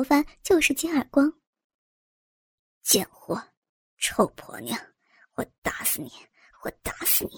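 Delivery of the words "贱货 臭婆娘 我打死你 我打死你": low, hissed curses that fade away; a violent father hisses threats, low and quiet; cursing father. A narrator's reading. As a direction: voice him with low, furious whispering. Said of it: "边骂".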